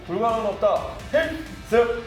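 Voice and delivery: high, barked unit motto; korean military style. Acted. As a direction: shout the salute at attention. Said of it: "우리 군대식으로 우리 시청자분들에게 인사". (군대식 구호 경례)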